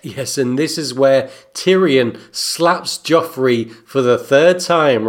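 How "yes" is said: ordinary talk, nothing marked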